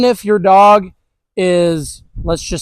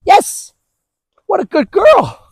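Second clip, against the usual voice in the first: much higher, bursting with delight; excited dog praise; 11 semitones higher, slower than usual. Real, not acted. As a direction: gush over the dog like she just won a prize.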